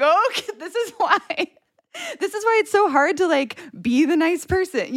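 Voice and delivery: high, laughing disbelief; retelling a stranger screaming at her, laughing high. Real, not acted.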